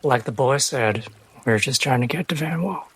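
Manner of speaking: ordinary talk, nothing marked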